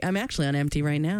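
ordinary delivery

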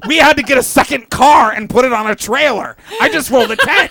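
fast, loud, high laughing brag; mock excitement, laughing; excited game play. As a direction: brag about your game pieces.

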